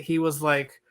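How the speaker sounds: ordinary talk, nothing marked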